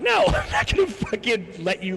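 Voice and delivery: high shout broken by laughter; mock outraged refusal. Speaker refuses to carry friend's grievances, mock outraged. Acted refusal in retold argument.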